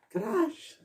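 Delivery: high, quiet mock call of crush; imitating Lana. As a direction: squeak it out like Lana cheering. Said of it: "she always like".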